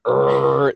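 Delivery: buzzing mock power drill; drill noise imitation. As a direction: growl it out like a jackhammer. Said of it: "so it sounds like a jackhammer".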